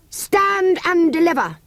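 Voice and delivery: high, slow playacted bandit call; a boy playing bandit demands the bread; mock highwayman cry. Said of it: "cried Cyril".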